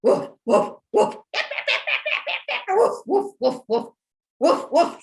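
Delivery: loud imitated woofs, varying in pitch; barking like a dog. Shown as a demonstration. Storyteller leads listeners barking like a dog, loud woofs. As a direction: bark in short, punchy bursts. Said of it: "Bark like that dog".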